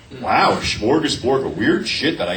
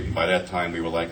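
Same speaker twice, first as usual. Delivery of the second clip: usual speaking voice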